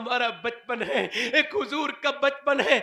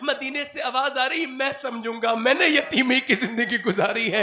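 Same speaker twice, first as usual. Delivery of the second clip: fiery preaching; 5 semitones higher, 5 dB louder than usual. Acted. Preaching at full force.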